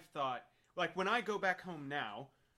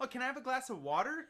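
wife's put-on American accent, higher and louder; mock American accent; 10 semitones higher, 6 dB louder than usual. Imitating wife asking for water, mock American accent. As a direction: imitate an English woman faking American. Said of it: "whole sentence in an American accent".

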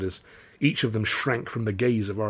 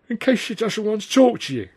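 hint of a bruised drunk stalling; lightly voiced battered Mike; 11 semitones higher than usual. A narrator's reading. Voicing beaten-up Mike.

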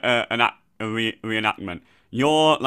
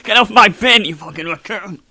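high-pitched comic yell; hammed-up indignant holler; mock outrage; 7 semitones higher, 8 dB louder than usual. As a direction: shout it like defending your turf. Acting out raccoon fight over bin.

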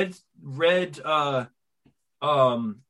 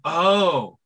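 relieved realization; 4 dB louder than usual. Retold or imitated. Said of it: "this sigh of relief".

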